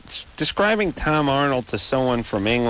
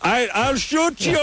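hammed-up Austrian accent, higher and slow; mock Schwarzenegger as a Chicago cop, higher and slow; 12 semitones higher, much slower than usual. Host mocks Schwarzenegger's cop line in a hammed-up Austrian accent. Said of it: "And he's like".

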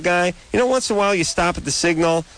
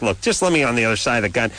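fast pleading; 4 semitones lower, much faster than usual. Retold or imitated. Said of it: "and I'm like".

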